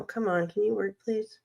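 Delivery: usual speaking voice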